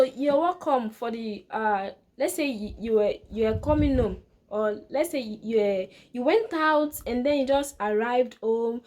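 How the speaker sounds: ordinary talk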